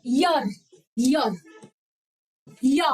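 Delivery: यर, यर, then या, slowly and deliberately; sounding out the y. Shown as a demonstration. Teacher repeating the Y sound for students. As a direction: break the sound into syllables, slowly. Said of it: "अब या बताओ".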